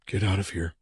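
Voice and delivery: soft, hushed order to leave; whispered warning. A narrator's reading. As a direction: whisper it under your breath. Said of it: "the man whispered".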